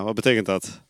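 usual speaking voice

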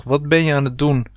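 plain reading voice

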